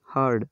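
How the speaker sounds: हर्ड as one syllable, falling; correct pronunciation. Shown as a demonstration. Teacher repeats it for learners, correct pronunciation. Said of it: "यहाँ इसका उच्चारण होगा हर्ड".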